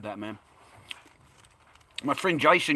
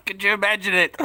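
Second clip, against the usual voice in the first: laughing; 7 semitones higher, 8 dB louder than usual. Real, not acted. Repeats it laughing.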